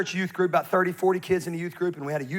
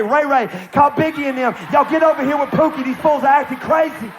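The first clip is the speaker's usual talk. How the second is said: angry phone call; 7 semitones higher, 8 dB louder than usual. Retold or imitated. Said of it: "She's like".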